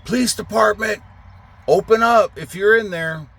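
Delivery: high, loud mock police call at the door; cop shouting. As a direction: bang on the door and announce police. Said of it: "one's on the".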